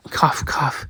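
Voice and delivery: coughed out, soft and voiceless; a faint dry cough; breathy cough. Acted. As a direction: cough weakly, parched. Character coughs in the heat.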